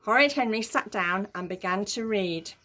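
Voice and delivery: ordinary delivery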